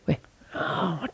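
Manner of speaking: hushed Cockney menace; Ray Winston impression. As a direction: breathe the threat like a London hard man. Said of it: "Ray Winston".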